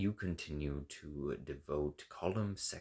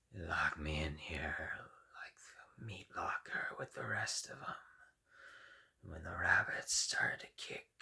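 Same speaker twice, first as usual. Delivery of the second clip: hoarse, unvoiced creeping threat; menacing whisper; 6 dB quieter than usual. Frozen splicer threatening Cohen's messenger, menacing whisper. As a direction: hiss it close and cold.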